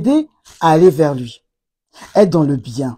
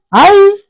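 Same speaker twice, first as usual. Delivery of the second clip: aïe; 10 semitones higher, 6 dB louder than usual. Real, not acted. Exasperated cry.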